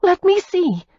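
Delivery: loud, high urgent plea. A narrator's reading. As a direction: insist on seeing the wound. Insisting, worried.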